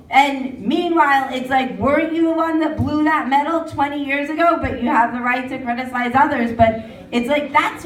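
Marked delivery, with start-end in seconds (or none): none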